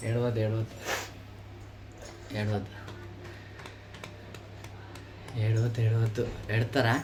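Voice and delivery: low, soft soothing voice to a crying sister; soft comforting. Real, not acted. Consoling her crying sister. (మెల్లగా ఓదార్చే గొంతు)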